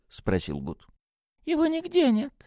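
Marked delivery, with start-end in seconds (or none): 1.5-2.3 very high-pitched priest voice; little priest. A narrator's reading. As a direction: state it simply, voice way up high. (священник высоким голосом)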